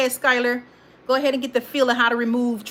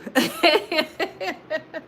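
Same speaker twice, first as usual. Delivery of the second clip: laughing; 7 semitones higher than usual. Real, not acted. Host amused by her suggested chat reply, laughing. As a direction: giggle at your own suggestion.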